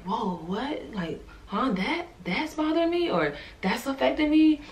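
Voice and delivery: soft surprise. Retold or imitated. Realizing hidden hurts while praying.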